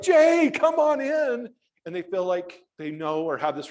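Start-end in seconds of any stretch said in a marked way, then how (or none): 0.0-1.5 higher, louder, slow gushing welcome; imitating an eager fan. Fan at the door welcoming Jay Leno, gushing. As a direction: welcome him like a beloved friend.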